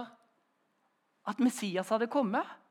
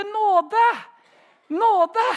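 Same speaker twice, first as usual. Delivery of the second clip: higher, louder cry of surrender; calling for mercy; 9 semitones higher, 10 dB louder than usual. Shown as a demonstration. Preacher showing how to cry mercy to God, loudly. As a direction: shout it out like giving up the fight. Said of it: "det er best å rope".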